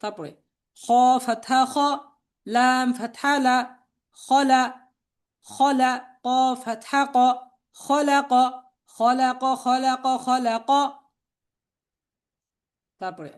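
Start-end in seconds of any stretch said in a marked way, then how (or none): 0.8-10.7 level, measured chant with drawn-out letter names; spelling drill recitation. Shown as a demonstration. Teacher spelling out Arabic words for learners to repeat, chanted drill.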